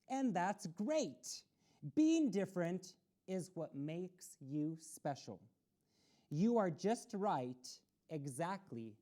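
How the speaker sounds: a steady picture-book reading voice, pausing between pages; storybook read-aloud. A narrator's reading. Children's story host reading the book to young listeners, read-aloud voice.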